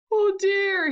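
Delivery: mildly panicked little cry; alarmed snake. Acted. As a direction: react with storybook alarm. Snake frightened by the Gruffalo.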